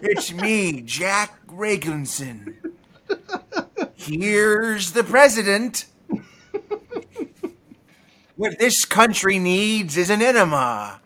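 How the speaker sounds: slow, drawn presidential voice; hammed-up old president; Reagan-Nicholson mashup impression; Reagan doing Nicholson. Shown as a demonstration. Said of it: "Reagan doing Nicholson".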